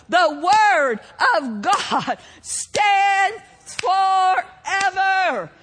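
ringing, drawn-out sermon delivery; full-force preaching. Real, not acted. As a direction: proclaim it to the congregation with mounting fervour.

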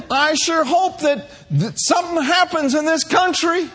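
high, mock-whiny lament; high mock lament, half laughing; complaining church sister. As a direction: moan like a gloomy church sister. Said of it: "Sister So-and-so says".

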